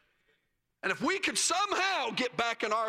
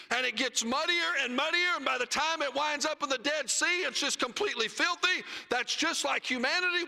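full-force preaching; 7 semitones higher than usual. Acted. Preacher crying out the gospel to his church, raised and ringing.

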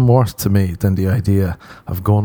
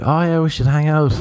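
higher-pitched imitation of a keen girl; girl's eager invite; 7 semitones higher than usual. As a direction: lightly mimic her, pitched up. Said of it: "she kept being like".

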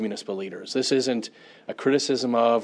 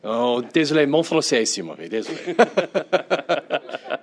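halting, slow French in an English accent, laughing; foreign accent in french; 3 semitones higher, 3 dB louder, slower than usual. Real, not acted. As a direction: stumble through apologetic French with an anglophone accent. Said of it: "we'd like you to say that in French".

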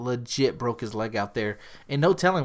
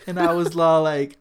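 usual speaking voice

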